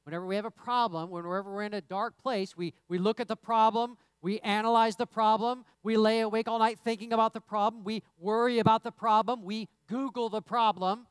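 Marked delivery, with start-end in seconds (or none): 2.6-11.1 preaching emphasis. Acted.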